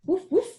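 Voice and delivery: high, bright mock dog bark; playful woof. Shown as a demonstration. Teacher barking woof woof for little students. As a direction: bark it like a puppy for the kids.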